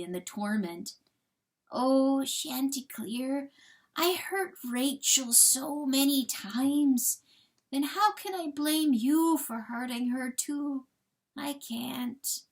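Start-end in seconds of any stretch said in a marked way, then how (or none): 1.7-12.4 higher, slow, tender confession; gentle grieving coyote. Acted. Coyote figure forgives the rooster.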